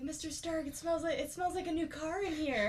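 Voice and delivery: put-on high, excited kid voice; Peter Parker. Young hero in his new suit to Stark, high boyish voice. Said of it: "when he gets into his new spider".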